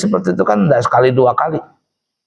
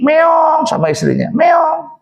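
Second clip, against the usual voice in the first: meek kitten meow; 12 semitones higher, slower than usual. Acted. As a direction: turn into a timid house cat for the wife. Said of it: "jadi kucing".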